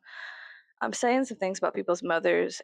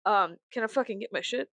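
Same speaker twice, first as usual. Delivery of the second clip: normal talking voice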